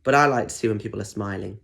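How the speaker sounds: ordinary delivery, nothing marked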